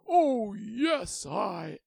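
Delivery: loud, high triumph; proud and overjoyed; triumphant. A narrator's reading. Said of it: "with triumph in his eyes".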